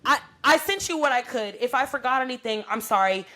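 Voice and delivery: put-on yelling back at the boyfriend at six fifteen; retold angry outburst. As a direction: let the anger burst out again. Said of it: "And I got so mad".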